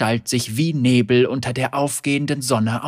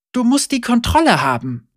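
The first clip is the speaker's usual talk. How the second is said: higher, louder teacher's voice; instructing Senna; 10 semitones higher, 4 dB louder than usual. A narrator's reading. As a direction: drill him patiently. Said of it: "sagte Senna".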